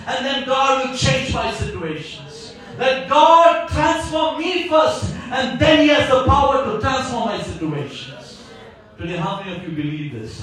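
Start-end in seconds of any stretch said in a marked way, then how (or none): 2.8-5.1 slow, raised and deliberate; slow emphatic proclamation. Real, not acted. Preacher urging the church, slow and raised. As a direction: spell it out slowly like a command. Preacher slows key line.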